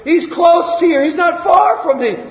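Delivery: high, rising excited cry; urgent longing. Acted. Bartimaeus realizing Jesus is near, urgent longing. Acted beggar's urgent desire.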